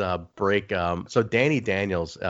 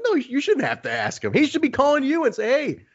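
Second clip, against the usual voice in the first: pitched way up, needling a friend; hammed-up teasing, higher; ribbing high voice. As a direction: rib him in a high mock voice. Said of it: "I'm like".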